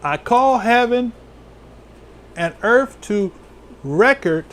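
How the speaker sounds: slow, measured verse read aloud; scripture reading. Acted. Preacher reading the verse to the congregation, slow and measured. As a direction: lift each phrase of the verse.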